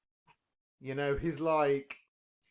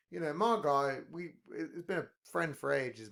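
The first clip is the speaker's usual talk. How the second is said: ordinary talk, nothing marked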